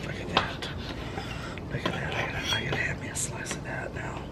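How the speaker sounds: hushed whisper while coring the tomato. Real, not acted. To viewers over the chopping board, whispering. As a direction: breathe the words, no voice.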